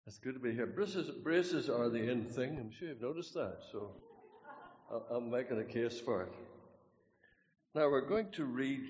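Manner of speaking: normal talking voice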